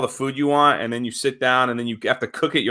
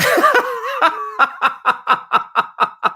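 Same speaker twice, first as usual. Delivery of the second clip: high burst of laughter. Real, not acted. Laughing at the story.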